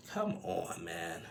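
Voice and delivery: quiet, dismayed aside; retold soft, weary protest; let-down disbelief. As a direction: drop the volume in disappointment. Retold dismayed reaction to her remark.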